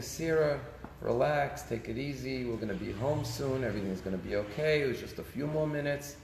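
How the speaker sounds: soft, soothing self-talk of a mother; quietly reassure, low and even, as the imitated mother; calm mother. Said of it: "the mother very calmly is saying".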